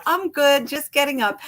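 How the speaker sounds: breezy fake cheer. Imitating husband's breezy lie.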